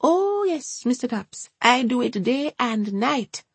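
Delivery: high, Jamaican-lilting grandmother; a high, fussy Jamaican gran chatting; Hortense's Jamaican voice. A narrator's reading. Hortense assures Ryan.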